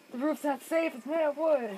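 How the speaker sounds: panicking gamer. Real, not acted. Tornado reaching the house.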